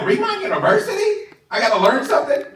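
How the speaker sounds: high, laughing mock whine of a reluctant recruit; scoffing high-pitched mock; mocking quitter. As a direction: squeak out the lazy excuse. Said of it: "They're like".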